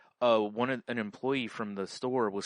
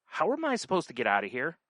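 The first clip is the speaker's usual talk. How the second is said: raised, indignant and sassy; sassy complaint; 7 semitones higher, 4 dB louder than usual. Retold or imitated. Blocked-in woman snaps at the paramedic, sassy. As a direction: demand it like the whole lot owes you. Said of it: "with all the sass".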